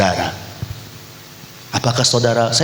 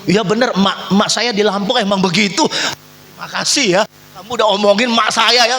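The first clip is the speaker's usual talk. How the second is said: high-pitched sarcastic agreeing voice; mock naive child; 8 semitones higher than usual. Preacher mocks a child thanking the gossip, high sarcastic voice. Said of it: "apa saudara".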